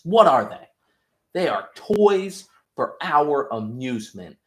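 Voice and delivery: high, loud, exasperated rant, rushing the middle; scolding the kid as if it's obvious; imitated angry lecture, pitch jumping up; mock furious Homelander. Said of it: "Homelander's like".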